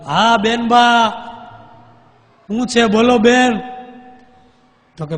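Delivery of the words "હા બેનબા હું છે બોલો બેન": slow, loud, high reply from afar; answering call. Acted. Ghost answers the queen as her brothers, calling back loudly. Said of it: "એને હોકારો દીધો".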